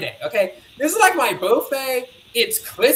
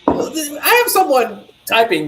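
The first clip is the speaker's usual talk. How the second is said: ordinary delivery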